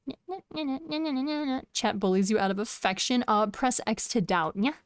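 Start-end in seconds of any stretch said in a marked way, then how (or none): 0.1-1.6 high, soft nya chatter; cutesy cat. Pretend. Streamer idly playing cat for chat, high soft mewing. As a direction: patter it out like a little cat.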